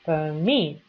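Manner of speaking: permit said per-MIT, second syllable lengthened; stress on second syllable. Shown as a demonstration. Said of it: "y ahí tengo la acentuación en meet".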